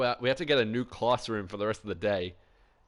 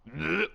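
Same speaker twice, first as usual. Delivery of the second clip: mock retching; 5 semitones higher than usual. Classmate vomiting in silent test.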